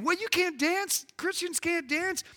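high-pitched scandalised objection; mock disapproval. Preacher mimics Christians objecting to swing dancing, mock scandalised.

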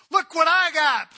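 taunting. Acted. David taunts Saul's bodyguard with the stolen spear, gloating. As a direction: rub it in his face. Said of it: "he taunts the bodyguard".

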